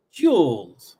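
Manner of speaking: "joules" with a J sound, falling tone; British-style pronunciation. Retold or imitated. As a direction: mimic their "joules", drawn out and falling. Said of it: "they say joules".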